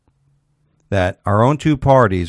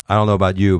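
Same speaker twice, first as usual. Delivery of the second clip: ordinary talk, nothing marked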